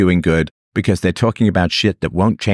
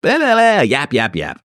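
mocking blah blah; 8 semitones higher than usual. Mocking critics' chatter.

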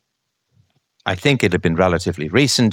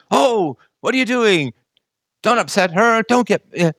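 much higher, slowed whiny scolding voice; mock a fussy defender telling him off; 11 semitones higher than usual.

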